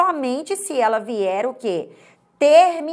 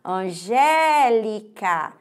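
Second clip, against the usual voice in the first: ANGÉLICA very slow, GÉ held long; calling out; much slower than usual. Shown as a demonstration. Teacher calls to count syllables, Angélica very slowly with a long GÉ. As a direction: draw out Angélica syllable by syllable, leaning on GÉ. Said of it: "Eu chamei a Angélica no portão".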